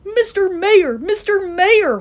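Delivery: high, loud, urgent call. A narrator's reading. Horton summons the mayor on the speck, calling out. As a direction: call down to someone tiny. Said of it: "Horton called".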